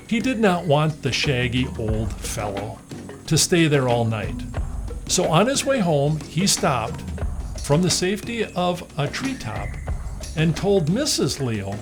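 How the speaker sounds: ordinary delivery, nothing marked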